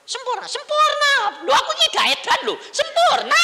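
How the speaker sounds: very high, screamed joy; hammed-up yell of amazement; ecstatic shouting. Memerankan seruan gembira yang heboh. (teriakan gembira melengking)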